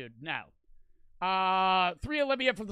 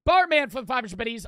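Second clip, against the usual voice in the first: loud shout out; 3 semitones higher, 8 dB louder than usual. Real, not acted. Shouting donation thanks.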